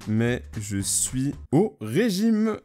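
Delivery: very slow, word by word; drawn-out typing voice; slow dictation. Real, not acted. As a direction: speak at typing speed. Dictates message while typing it.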